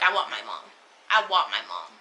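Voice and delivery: low, small voice, imitating tearful pleading; a hint of a scared child wanting mom; retold crying. Crying in the ambulance after the seizure, imitating tearful pleading. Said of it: "I'm crying".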